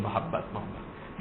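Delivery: a tender, laughing hush on the word; breathy laughing whisper. Real, not acted. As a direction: whisper it with a soft laugh, very slow.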